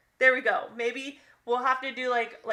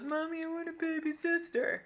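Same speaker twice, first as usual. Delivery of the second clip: put-on higher, sweet child voice asking mommy; little girl asking; 8 semitones higher, 3 dB quieter than usual. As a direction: whine it like a little daughter. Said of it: "she goes".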